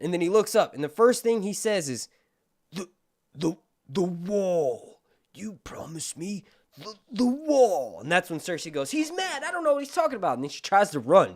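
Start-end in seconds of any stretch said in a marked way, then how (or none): 2.7-8.0 slow, halting stammer; broken prisoner. Retold or imitated. Osmond in chains accusing Cersei, halting and broken. 8.9-10.3 much higher, mock-frantic protest; panicked denial. Cersei denying Osmond's accusation, high mock panic.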